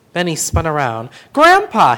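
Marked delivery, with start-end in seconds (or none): 1.3-2.0 sudden high, loud cry of his name; startled gasp. A narrator's reading. Boy caught by grandpa, gasping his name. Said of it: "he gasped".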